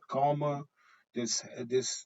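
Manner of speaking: ordinary delivery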